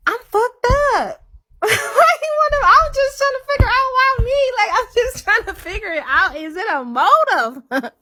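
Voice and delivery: very high, fast, squealing voice; shrill giddy squeak; squeaky disbelief. Real, not acted. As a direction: squeal it out half laughing.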